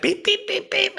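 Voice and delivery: high beeping chirps; bird chirps. Retold or imitated. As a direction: chirp like a caged bird answering. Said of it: "the bird's like".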